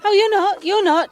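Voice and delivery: high, loud brushing-aside; firm insistence. Acted. Woman overruling a faint stranger's protest, insistent. Cutting off protests, helping him.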